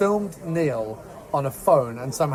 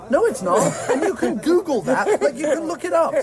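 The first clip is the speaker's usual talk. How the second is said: high, incredulous protest through laughter; laughing incredulity; 11 semitones higher than usual. Real, not acted. Film fan objecting to a wrong Tube direction, laughing incredulity. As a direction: voice shooting up in laughing disbelief. Scornful rebuttal of a film's mistake.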